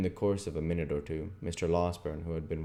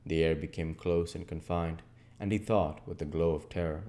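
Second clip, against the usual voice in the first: ordinary delivery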